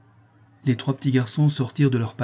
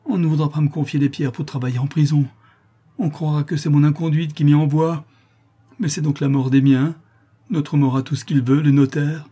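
raised, agitated lament; higher, distressed outpouring; despairing protest; 5 semitones higher, 5 dB louder than usual. A narrator's reading. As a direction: protest your ruin with mounting anguish. Artisan despairs over his family.

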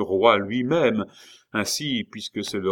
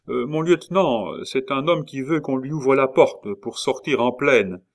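louder, higher soldier's report; calling up; 6 semitones higher, 6 dB louder than usual. A narrator's reading. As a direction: call it up to your superior officer. Soldat appelle son lieutenant à la lucarne. (soldat qui hèle son lieutenant)

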